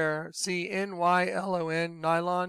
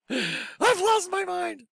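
high, slow, laughing crazy voice; laughing mock madness; 12 semitones higher, much slower than usual. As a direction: laugh it out like you have snapped. Laughing at himself as crazed.

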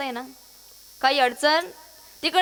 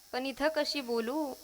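soft, slow, bashful voice; shy reply; 2 semitones lower, 10 dB quieter, slower than usual. Acted. Woman too shy to speak up here, said softly and slowly. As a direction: hold back, much softer and slower.

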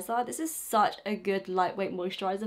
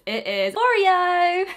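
brand reveal; 9 semitones higher, 7 dB louder, much slower than usual. Real, not acted.

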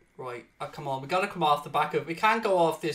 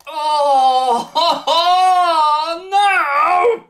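high, loud laughing wail of disbelief; anguished laugh-cry; 11 semitones higher, 14 dB louder than usual. Real, not acted. Gamer watching another goal go in, anguished laughing wail. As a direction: howl it out in despair.

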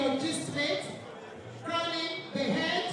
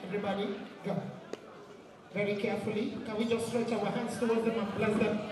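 lower, intoned prayer over the couple; blessing prayer; 5 semitones lower, faster than usual. Acted. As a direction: bless them like a pastor.

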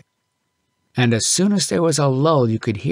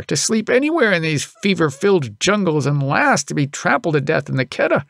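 higher, mildly worked-up father; father's scolding; 8 semitones higher than usual. A narrator's reading. Father warning his son about Peterson Sahib, mildly raised. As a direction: lecture the son with growing alarm. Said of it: "gave him a scolding".

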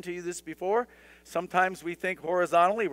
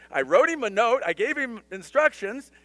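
a higher, louder exasperated Josh, fed up with fixing the radio; exasperated son; 7 semitones higher, 5 dB louder than usual. Retold or imitated. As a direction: complain like a son called back yet again. Son's complaint voiced in story.

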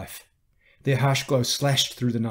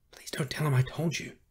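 higher, breath-only pleading; whispered plea; 5 semitones higher than usual. A narrator's reading. Old man begging Carrie to keep his secret, whispered.

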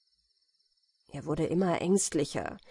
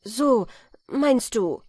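louder, higher indignant answer; eager retort; 5 semitones higher, 6 dB louder, slower than usual. A narrator's reading. Felix retorting eagerly to the compass-smith, louder and higher. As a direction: jump in hotly. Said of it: "entgegnete Felix sehr eifrig".

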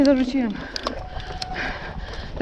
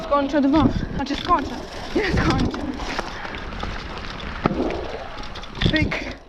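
muttering; 3 semitones higher, 14 dB quieter than usual. Real, not acted. Quiet muttering after crash.

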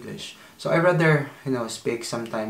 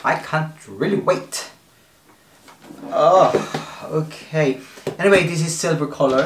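excited; 5 semitones higher, 8 dB louder than usual. Real, not acted. Opening the box, thrilled.

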